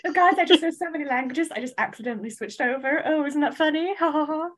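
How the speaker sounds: high, affected bragging voice with fake laugh; smug show-off. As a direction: ham up a smug polyglot. Mocking a multilingual show-off.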